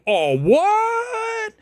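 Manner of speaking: very high, drawn-out squawk; mock indignation. Talker called out for bad behavior, mock indignation. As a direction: imitate someone absurdly affronted at being called out. Mocking offended person called out.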